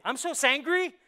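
loud, high, hammed-up complaint; playful mock outburst with a rising end; mock angry outburst. Acts out invented word for sad-angry.

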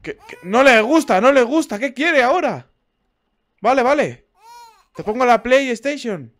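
high, loud frantic pleading; loud, high-pitched fluster; flustered panic. Real, not acted. Flustered by the upset baby.

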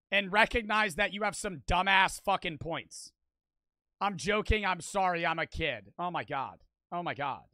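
normal talking voice

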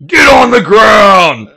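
loud, high, drawn-out action yell; stretched-out mock command, shouted; hammed-up shout. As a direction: bellow it slowly like an over-the-top cop.

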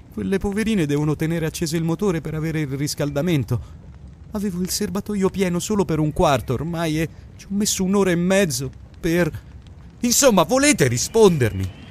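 anxious. A narrator's reading. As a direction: worry pushing the pitch up till he demands an answer. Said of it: "L'ansia era tornata nella sua voce".

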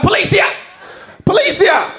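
high-pitched yell for police; imitated high shout; mock police call. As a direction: holler it out high, raising the alarm. Man on balcony calls police.

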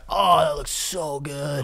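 slow, drawn-out craving; savoring mock awe. Viewer watching lava cake videos, savoring awe. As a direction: drool over it slowly.

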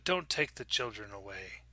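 low, soft plea, understated; quiet warning. A narrator's reading. Stranger behind the front door, quiet warning. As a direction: warn her softly, without raising your voice. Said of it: "a woman's voice said".

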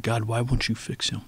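soft, far-off plea with a touch of tears; tearful prayer. Retold or imitated. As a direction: recall the night you cried to God, softly. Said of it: "tears are strolling down my, my cheeks".

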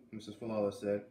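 ordinary delivery, nothing marked

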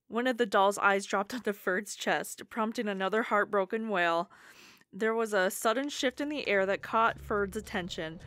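ordinary delivery

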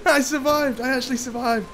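high-pitched, laughing disbelief; elated relief. Real, not acted. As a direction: exclaim it high, thrilled to be alive. Celebrates surviving.

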